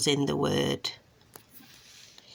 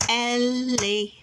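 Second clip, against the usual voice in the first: elephant in three long beats, el-e-phant, pitched up; syllable by syllable; 5 semitones higher than usual. Shown as a demonstration. Modelling taps for a child, syllable by syllable. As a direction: stretch out elephant, one beat per tap. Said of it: "as you say the word".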